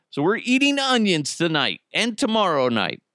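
normal talking voice

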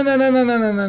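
high, rapid-fire protest; hasty denial. Real, not acted. Interviewer hastily denying the suggestion, emphatic hasty denial. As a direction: protest in a quick high-pitched string.

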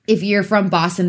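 ordinary talk, nothing marked

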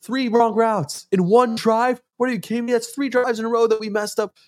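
high, exasperated yelling impression; mock Rodgers rant. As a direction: rip into the team like a furious quarterback. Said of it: "He's like".

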